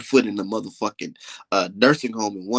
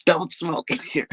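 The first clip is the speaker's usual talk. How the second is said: mocking old man; 7 semitones higher, slower than usual. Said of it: "that old man talk about some".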